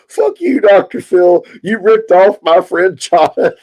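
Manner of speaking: high-pitched mock-angry imitation; mad outburst, imitated. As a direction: mimic a friend losing it, pitch jumping up. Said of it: "he gets so mad when I do Doctor Phil, and he's like".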